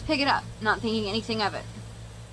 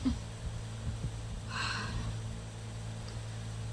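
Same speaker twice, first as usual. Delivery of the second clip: weary sigh; 11 dB quieter than usual. Real, not acted. Sigh between lines.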